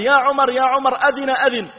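high, loud call across a distance. Retold or imitated. Guard calling Umar back, loud and high. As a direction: shout his name to bring him back. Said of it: "أخذ الحارس ينادي".

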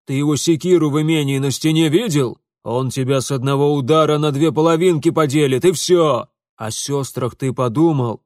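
loud tirade building to scandalized cry; outraged berating. Acted. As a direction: berate him for shaming the family. Father berates son over the scandal.

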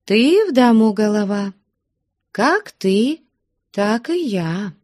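high-pitched, submissive voice; plaintive. A narrator's reading. Wife yielding to head of the house, plaintively. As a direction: yield meekly, pleading a little. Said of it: "жалобно промолвила Аксинья Захаровна".

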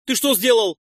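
indignant shout at a careless friend; outraged. Acted. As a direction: blurt it out in alarm and anger. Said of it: "возмутился Нойс".

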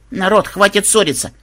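high-pitched exasperated plea; interrupting. A narrator's reading. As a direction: cut across a quarrel. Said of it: "перебила обоих внучка".

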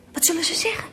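hushed anxious whisper; nervous whisper at court. Acted. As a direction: whisper so the tsar won't hear. Hushed before the tsar.

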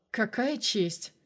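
slow, dry sarcasm; sarcastic scoff. A narrator's reading. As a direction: drawl it with a snort of irony. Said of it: "хмыкнул я".

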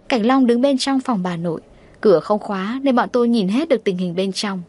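usual speaking voice

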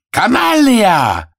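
high, loud drawn-out insult; angry shout. Acted. Italians curse Arthur, high angry shouting. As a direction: yell the insult high and long. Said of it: "кричат".

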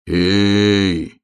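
long drawn-out call; calling from below. A narrator's reading. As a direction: call up from far away, holding the vowel. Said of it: "услышал он знакомый голос с земли".

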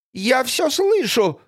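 high, slow hysterical cry; hysterical shout. Acted. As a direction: shriek it hysterically. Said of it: "выкрикнула Гризельда истерично". (истеричный выкрик)

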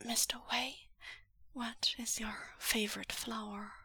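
rough, soft unvoiced whisper. Wen Yuan desperately asking Mister Wei, whispering. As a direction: claw the words up as a hoarse whisper. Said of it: "in a whisper that claws".

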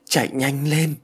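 insistent nudge to hurry; urging. A narrator's reading. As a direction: push him to run, voice lifted. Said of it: "con bé lại giục".

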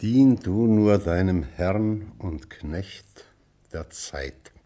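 slow, drawn-out recital sinking low at the end; deliberate, heavy-paced line of poetry; weighty poem quote. Acted. Teacher repeats the poem's last line.

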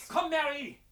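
high urgent cry to his wife; urgent call. Acted. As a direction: hurry her, calling out high. Urgent call to flee to ship.

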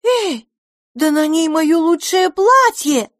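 high, loud indignant shriek. A narrator's reading. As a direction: squeal in outrage. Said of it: "взвизгнула Пипина".

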